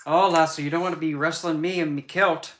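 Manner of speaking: loud mock Scottish challenge; Scottish accent. Streamer warning a wrestler about his kilt, in a loud Scottish accent. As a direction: boom it out like a Scottish brawler. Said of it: "he's Scottish and he has a heavy accent".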